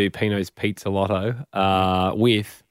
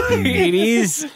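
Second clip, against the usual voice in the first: much higher laugh carrying it is; 3 dB louder than usual. Real, not acted. Host laughs replaying the Pino phone call, laughing. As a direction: squeal it out mid-laugh.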